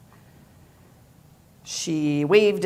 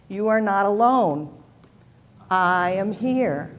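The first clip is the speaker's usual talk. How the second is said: emphatic refrain; 6 dB louder than usual. A narrator's reading. Affirmation repeated at climax.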